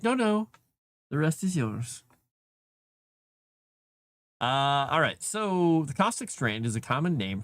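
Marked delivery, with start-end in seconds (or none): 0.0-2.0 voicing the cat. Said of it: "He's like".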